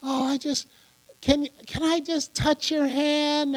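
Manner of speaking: hushed, stammering celebrity worship, put on; starstruck fan. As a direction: imitate a timid admirer begging a favor. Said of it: "people go to celebrities and".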